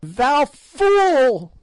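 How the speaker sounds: put-on old witch screaming at the king; shrieking old fiend. A narrator's reading. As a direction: spit the insult out in a screech. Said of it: "shrieked the old fiend".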